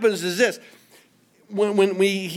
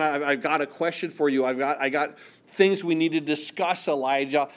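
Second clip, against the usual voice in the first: ordinary talk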